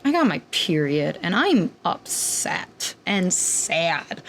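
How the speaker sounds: slow, low, droopy self-pity; mopey sad. Retold or imitated. Telling Kirby about her period, slow mopey sadness. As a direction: drag it out like a sulky confession.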